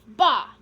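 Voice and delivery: loud scornful bah; contemptuous bah. Acted. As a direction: snort out the dismissal. Count dismisses the critics.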